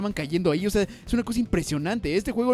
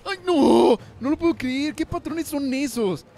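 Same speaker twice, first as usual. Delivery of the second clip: panicked disbelief; 7 semitones higher, 4 dB louder, slower than usual. Real, not acted. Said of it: "Me estoy poniendo muy nervioso".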